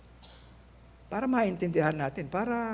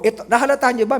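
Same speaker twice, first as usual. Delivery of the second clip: ordinary talk, nothing marked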